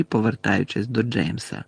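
usual speaking voice

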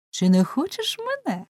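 put-on high girlish offer; eager dark-haired girl. A narrator's reading. Second girl offering herself, high coaxing question. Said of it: "прискочила чорнява дівчина".

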